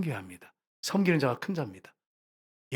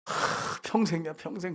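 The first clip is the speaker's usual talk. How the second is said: exasperated breath, then a muttered complaint; imitated grumbling; 3 semitones higher, slower than usual. Wife complains about husband when he's absent, mock grumbling. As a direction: act out grumbling behind someone's back. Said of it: "웅시렁웅시렁 하면서".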